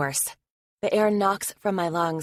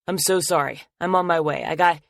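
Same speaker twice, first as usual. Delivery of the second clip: louder, urgent rush of words; hurried apology; 5 dB louder than usual. Acted. Logan apologizing on the phone while late, urgent and loud. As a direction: blurt it out fast and worried.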